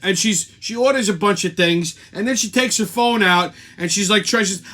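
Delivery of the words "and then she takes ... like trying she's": usual speaking voice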